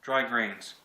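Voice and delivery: normal talking voice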